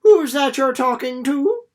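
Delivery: loud, high worried granny; loud high suspicious grandmother; calling out. Acted. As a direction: call from the cottage door, anxious.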